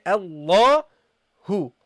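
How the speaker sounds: Allahu drawn out slowly, lam full and heavy; slow heavy recitation. Shown as a demonstration. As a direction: stretch Allahu, thick on the double l. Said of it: "then you go heavy".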